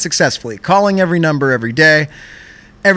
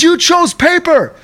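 mock scolding agent; 12 semitones higher than usual. Agent scolding the caller about choosing paper. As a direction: scold him like a disappointed dad. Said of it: "the most angriest".

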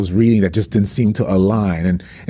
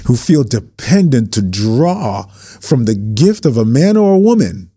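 impassioned preaching; 3 semitones higher than usual. Real, not acted. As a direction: preach it hard to pastors. Preaching continues.

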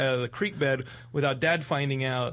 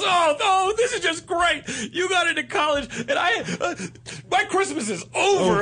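imitating a distraught brother, high wailing and loud; hammed-up tearful rant; 4 dB louder than usual. Brother whose Christmas is ruined ranting at his sibling, tearful wail. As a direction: whine and wail in mock despair.

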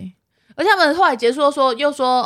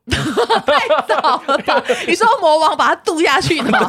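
laughing; 6 semitones higher, 3 dB louder, slower than usual. Real, not acted. Laughing disbelief.

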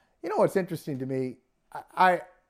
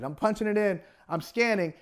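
ordinary delivery

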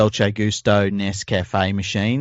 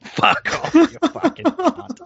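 swearing tirade; slower than usual. Pretend. Mock outburst at co-host's joke.

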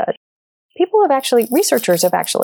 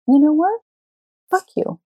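retold outburst; 8 semitones higher than usual. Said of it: "I lost it".

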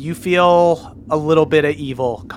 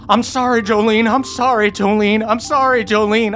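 high, wailing tortured tree voice; tortured tree screaming apologies; 9 semitones higher than usual. Acted. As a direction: wail it over and over like a haunted tree. Tree screams at Moonshine in head.

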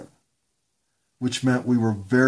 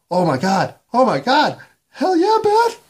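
rising to a shrill, loud shriek; shrieking jackpot winner; 13 semitones higher, 6 dB louder than usual. Retold or imitated. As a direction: scream it higher and higher in disbelief. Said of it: "hot-blooded American boy thinking".

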